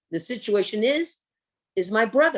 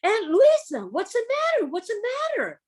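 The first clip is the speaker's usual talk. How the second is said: put-on worried kids; 11 semitones higher than usual. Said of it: "They're like".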